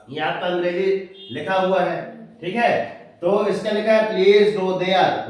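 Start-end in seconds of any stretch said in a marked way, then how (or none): 4.1-5.3 words stretched slowly; slow model reading. Shown as a demonstration. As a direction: read slowly so students copy. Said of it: "उच्चारण लिख दिया हमने".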